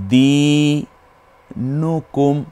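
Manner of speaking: দিনুকুম in drawn-out separate pieces; broken up slowly. Shown as a demonstration. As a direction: hold the long দি, then নুকুম on its own. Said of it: "একটু ভেঙে ভেঙে পড়ি".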